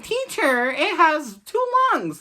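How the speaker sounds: very high put-on pupil voice; mock schoolkid. Pupil reporting to teacher at a dissection, in high mock-kid voice. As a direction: pipe up like a kid in class, high and drawn out.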